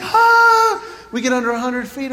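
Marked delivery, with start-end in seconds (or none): 0.0-0.8 high loud panicked ah; a sudden loud high yelp of panic; panicked yell. Retold or imitated. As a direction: cry out as the plane nearly hits water. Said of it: "And I'm just like".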